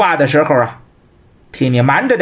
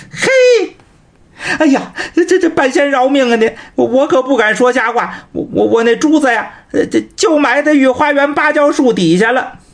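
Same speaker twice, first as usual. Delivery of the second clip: mock begging for mercy; 6 semitones higher than usual. 半仙饶命啊你.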